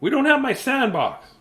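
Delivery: put-on high child's voice; eager child. A narrator's reading. Matthew tells his parents, high child voice. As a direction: plead like a kid.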